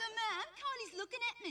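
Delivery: high-pitched mock tattletale brother; tattling. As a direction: imitate a little brother telling on his sister to Mum. Brother telling on sister to Mum.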